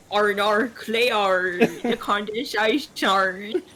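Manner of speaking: mock Australian accent. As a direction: stretch it out in a silly Australian accent. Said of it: "the only accent she does".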